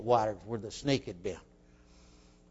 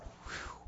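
soft, voiceless blown whew; acted sigh of nerves; nervous whew; 18 dB quieter than usual. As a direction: blow out a quiet, uneasy breath. Boy's nervous thought as train arrives.

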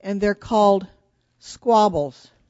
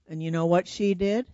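ordinary delivery, nothing marked

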